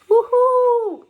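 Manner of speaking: high, loud excited whoop; cheering woohoo. Acted. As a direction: cheer like a thrilled kid. Cheering with ladybugs.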